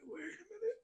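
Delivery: put-on high quavering old man, slow and quiet; whimpering old man giving up; high, slow, shaky elderly whine; mock senile Biden. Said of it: "it's like".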